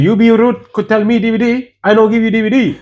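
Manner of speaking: put-on high-pitched Chinese-accented refusal; mock Chinese accent. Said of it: "like this, it was Chinese accent".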